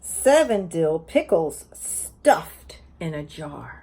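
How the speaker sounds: slow, lilting picture-book read-aloud; sing-song story reading to a class; reading to children. Acted. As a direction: read each page like story time for little ones.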